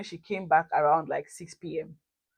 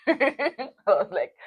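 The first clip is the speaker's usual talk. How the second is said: laughing; 6 semitones higher, much slower than usual. Real, not acted. Laughing while retelling.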